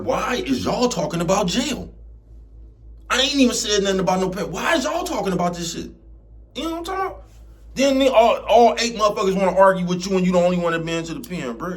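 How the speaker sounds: fed-up rant about friends talking about jail; exasperated rant. Acted. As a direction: get fed up and let it climb. Rant about arguing over jail.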